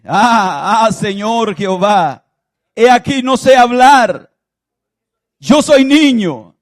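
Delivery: loud, high pleading protest; slow, loud plea of a reluctant prophet; Jeremiah's cry. Acted. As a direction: cry out like a frightened boy. Preacher acts out Jeremiah's protest.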